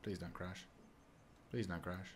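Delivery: a soft, hushed little plea; quiet plea. Real, not acted. Hoping the game survives the turn, hushed pleading. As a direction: beg the computer under your breath.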